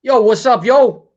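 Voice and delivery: mock New York street greeting. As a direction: call it out like a guy from the block. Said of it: "my y'alls become yos".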